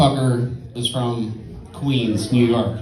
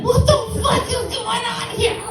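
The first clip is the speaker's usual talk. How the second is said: very high falsetto mock panic; bewildered squeal. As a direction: squeak out your confusion, hammed up. Acts his own alarm in brawl.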